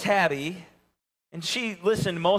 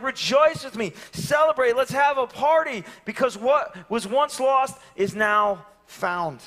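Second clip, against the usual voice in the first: joyful invitation; 8 semitones higher, 4 dB louder than usual. Retold or imitated. Celebrating party invitation quote.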